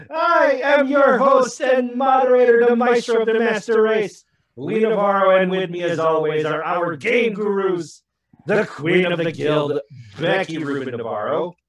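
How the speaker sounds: high, loud sports announcer; football announcer voice. Acted. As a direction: call the names like a stadium announcer. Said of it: "the full, uh, football, uh, announcer voice".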